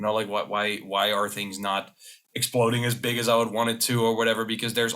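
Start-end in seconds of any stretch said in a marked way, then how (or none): none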